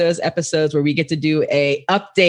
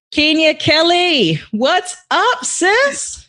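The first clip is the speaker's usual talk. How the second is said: excited greeting; 10 semitones higher, much slower than usual. Real, not acted. Host greets returning guest excitedly.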